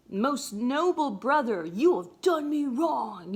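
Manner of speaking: teacher voicing an aggrieved Cassius; indignant Cassius. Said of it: "he's like".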